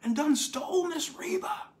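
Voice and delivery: put-on very high, wailing old man; groaning old man. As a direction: wail it, clinging in despair. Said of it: "he groaned again".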